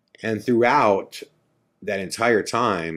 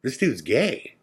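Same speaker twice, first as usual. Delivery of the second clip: higher-pitched dawning realization; sudden realization; 4 semitones higher, 4 dB louder than usual. Retold or imitated. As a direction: act out the penny dropping. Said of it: "And then it hits me".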